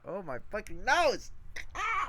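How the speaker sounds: loud pained yelp, high; real yelp of pain; sudden pain. Real, not acted. Reader hurts nose mid-read.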